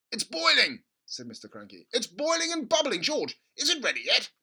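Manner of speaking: high, loud and bouncing; an excited dad watching the pot, loud; thrilled father. Acted. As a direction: hop with excitement. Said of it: "hopping about".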